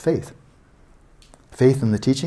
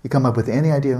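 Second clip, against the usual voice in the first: ordinary delivery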